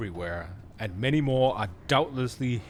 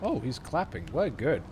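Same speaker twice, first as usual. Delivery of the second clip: usual speaking voice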